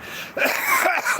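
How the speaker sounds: sudden high breathy laugh; burst of laughter. Real, not acted. Laughing at the absurd video titles, a real high laugh. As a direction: cracks up at the memory.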